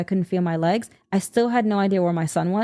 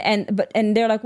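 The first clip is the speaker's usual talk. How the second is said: ordinary delivery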